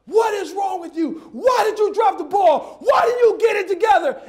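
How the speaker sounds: full-voiced furious coach impression; yelling coach. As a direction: bellow at a player who messed up. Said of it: "So when a coach yells".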